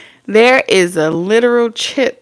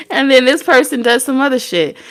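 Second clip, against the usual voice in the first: usual speaking voice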